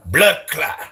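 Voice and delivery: high, punched Jamaican exclamations; mock a Jamaican homie in bursts; Jamaican impression. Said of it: "The homie in Jamaica".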